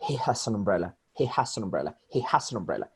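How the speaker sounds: "He has an umbrella" softly run together as "he ha-za-numbrella"; fuse "has an umbrella" into one word; flowing "He has an umbrella", no gaps; linked sounds. Shown as a demonstration. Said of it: "link sounds all the time".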